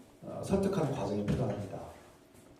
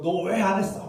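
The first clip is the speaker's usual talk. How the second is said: high, loud scolding question; yelling parent; 7 semitones higher, 5 dB louder than usual. Retold or imitated. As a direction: shout it at the child. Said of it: "막 소리 지르고".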